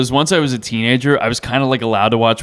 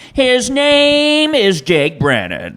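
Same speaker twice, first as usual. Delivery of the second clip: slow, higher TV host drama; Chris Hansen impression; 3 semitones higher, slower than usual. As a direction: intone it like a sting show host. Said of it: "I have to do him going".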